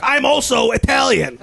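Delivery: high, slow, hammed-up Jersey boast; mock tourist desperate to belong; Italian American abroad. Italian American trying to fit in, hammed-up announcement. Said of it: "who act like that in Italy".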